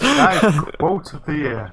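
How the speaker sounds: slowed robotic voice from bad connection; glitchy robot voice, dragged out. Real, not acted. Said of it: "now [name] sounds like a robot".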